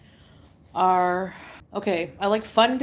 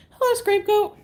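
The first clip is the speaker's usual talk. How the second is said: high greeting; 12 semitones higher, 4 dB louder than usual. Real, not acted. Greeting a chat viewer.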